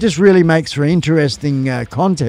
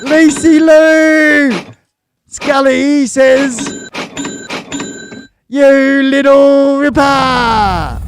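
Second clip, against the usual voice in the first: excited shout; 9 semitones higher, much slower than usual. Real, not acted. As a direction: stretch it out like a cheering announcer. Excited streamer reading donation.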